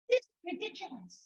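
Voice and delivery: high, put-on voice of a fed-up kid; child's complaint. A seven-year-old scoffing at the bags of stuff.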